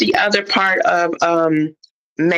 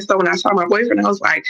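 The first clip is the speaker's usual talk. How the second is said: normal talking voice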